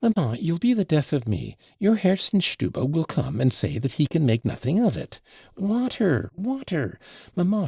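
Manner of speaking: a hint of a bossy daughter in a panic; frantic girl. Lise bosses her mother about the wound, petulant and frantic.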